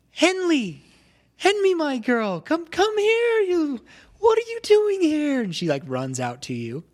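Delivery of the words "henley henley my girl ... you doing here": high, loud joyful calling out; a mother overjoyed to see her daughter, much higher and louder. Acted. Mother spotting her daughter on the path, joyful high call. GM voices mom greeting daughter.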